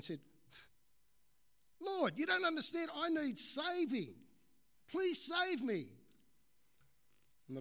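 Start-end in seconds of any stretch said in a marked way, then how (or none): 1.8-5.9 high, very loud begging; desperate pleading shout. Acted. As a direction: cry out to be rescued. Man begs again, still hanging.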